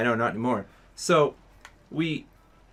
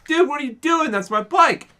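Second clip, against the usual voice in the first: much higher, louder outraged call; retold kid yelling at a stranger taking his bike; indignant yell; 12 semitones higher, 5 dB louder than usual. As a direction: shout at the bike thief. Stranger grabs speaker's bike.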